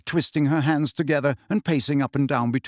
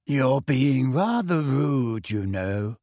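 serenely; much slower than usual. A narrator's reading. Luna calmly telling Harry he's rude, serene and slow. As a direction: float it out placidly, unbothered. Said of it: "said Luna serenely".

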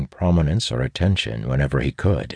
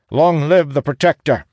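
high, loud acclamation; rallying hail; 12 semitones higher, 6 dB louder than usual. A narrator's reading. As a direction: hail the new leader loudly. Captain hails the new leader.

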